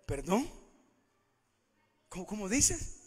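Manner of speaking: soft, stunned questioning; in shock. Retold or imitated. Woman found out by the Lord, quietly shocked. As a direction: ask it quietly, as if taken aback. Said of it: "la mujer quedó en shock".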